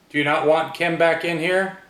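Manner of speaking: loud challenge to a spirit; provoking. Real, not acted. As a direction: call out, daring the ghost.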